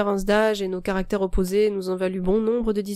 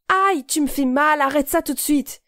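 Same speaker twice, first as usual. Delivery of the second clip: pained cry; 7 semitones higher, 5 dB louder than usual. Acted. Girl to the boy twisting her wrist, crying out in pain. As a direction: plead through the pain.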